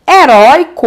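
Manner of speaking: "Heróico" broken as he-rói... co; "Heróico" in beats with the middle syllable held. Shown as a demonstration. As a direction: lean loudly on rói in "Heróico", then a separate co. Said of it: "é a penúltima e não a última".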